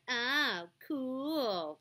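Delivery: put-on "Oh, cool" sung up and down, teasing the girls; mocking sing-song. Shown as a demonstration. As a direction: tease them with a lilting "Oh, cool". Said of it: "in a sing-song voice would be like".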